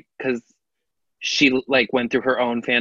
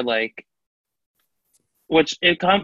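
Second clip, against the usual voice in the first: ordinary talk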